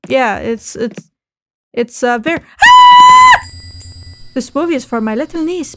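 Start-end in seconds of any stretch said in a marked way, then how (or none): 2.4-3.4 high, loud startled shriek; sudden scream. Real, not acted. Streamer startled mid-sentence, loud shriek. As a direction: let out a sudden shrill scream.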